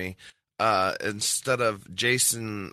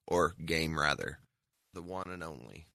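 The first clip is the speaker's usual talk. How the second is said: ordinary delivery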